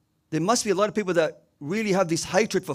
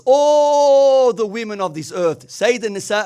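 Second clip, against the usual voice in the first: drawn out, loud and high, slowed for effect; a slow, ringing cry of praise; preaching at full force; 3 semitones higher, 7 dB louder than usual. Acted. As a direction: swell up and proclaim it to the whole hall. Climax of praise for Fatima.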